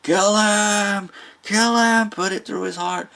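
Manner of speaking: captain screaming at his mate to kill the whale; frantic screaming. Retold or imitated. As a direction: scream the order, voice cracking high. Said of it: "Pollard screaming".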